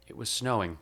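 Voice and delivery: ordinary delivery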